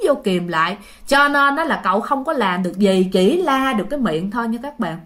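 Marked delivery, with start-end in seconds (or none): none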